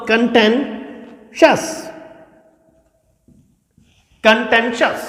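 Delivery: contentious said very slowly, kən-TEN-shəs; model pronunciation. Shown as a demonstration. Teacher modelling after spelling it out, slow. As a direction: stretch contentious out for the class. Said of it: "देखिए कैसे होगा".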